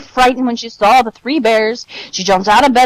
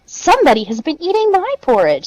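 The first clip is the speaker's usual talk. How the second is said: higher-pitched mother bear voice; middle-sized Mother Bear; 8 semitones higher than usual. A narrator's reading. Mother Bear finds her porridge eaten, middle-sized voice. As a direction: lift the voice for a mama bear. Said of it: "in her middle-sized voice".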